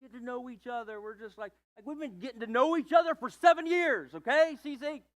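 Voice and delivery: dodging the where-is-this-going question; dodging partner. Retold or imitated. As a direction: brush it off, then snap at the delay. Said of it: "you can't be just like".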